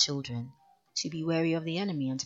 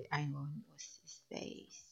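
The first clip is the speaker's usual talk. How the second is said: whispered aside; 11 dB quieter, slower than usual. Real, not acted. Muttered aside, forgetting name.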